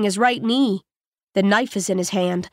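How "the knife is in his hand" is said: plain reading voice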